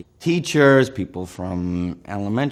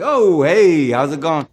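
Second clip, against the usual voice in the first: much higher and louder, put-on Brooklyn greeting; fake Brooklyn accent; 8 semitones higher, 9 dB louder than usual. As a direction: slip back into the fake accent brightly. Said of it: "what happened to your accent".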